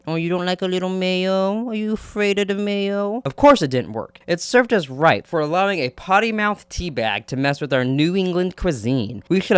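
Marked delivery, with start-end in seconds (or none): 0.1-3.2 higher, teasing voice, mocking; mocking taunt. Taunting the chef about the mayo, mocking. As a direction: sneer at him playfully.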